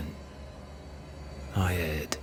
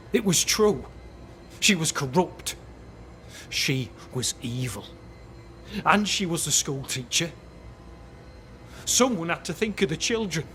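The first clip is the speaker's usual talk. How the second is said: insistent; 8 semitones higher than usual. Acted. Captain defends witch accusation.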